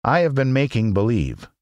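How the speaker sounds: mild, apologetic admission; meek. Acted. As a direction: confess it meekly. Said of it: "said the little man meekly".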